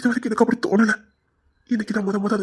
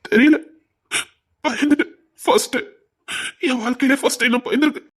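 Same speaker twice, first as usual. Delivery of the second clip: tearful; 3 semitones higher than usual. Acted. Husband admitting his fear to his wife, tearful. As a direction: blurt it out near tears. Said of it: "ஏன் குழந்தை மாதிரி வருத்தப்படுறீங்க".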